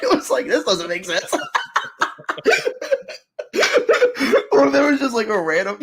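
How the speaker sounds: high, loud words breaking into laughter; laughing hard. Real, not acted. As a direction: barely get it out through helpless laughter. Joking, cracks up at own example.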